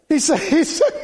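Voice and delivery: breaking into laughter mid-phrase; can't get the words out for laughing. Real, not acted. Preacher laughing before retelling a joke, words broken by laughter.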